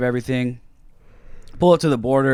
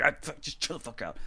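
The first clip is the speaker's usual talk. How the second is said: much softer, under the breath; urgent hushing; 3 semitones higher, 12 dB quieter than usual. Retold or imitated. Narrator quietly hushing bandmates in front of officers, urgent.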